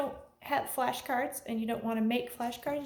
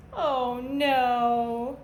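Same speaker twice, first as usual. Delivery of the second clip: louder mock "oh no!"; hammed-up storybook alarm; mock dismay; 6 dB louder than usual. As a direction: gasp it like a storyteller feigning shock. Button lost in story.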